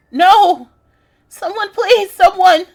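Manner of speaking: high, loud pleading call; desperate cry for help. Acted. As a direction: beg for rescue at the top of your voice. Reads character's cries for help.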